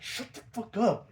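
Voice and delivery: angry retort. Retold or imitated. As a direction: act out an irritated Joker cutting someone off. Joker snaps at Robin's remark.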